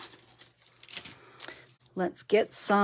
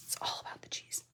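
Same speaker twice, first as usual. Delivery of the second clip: "all" stressed in a much softer, breathless whisper; fainter passionate whisper; 18 dB quieter than usual. Real, not acted. To her viewers, repeating the cheese line in a fading whisper. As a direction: let the cheese line fade into a near-silent whisper. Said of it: "said way too passionately".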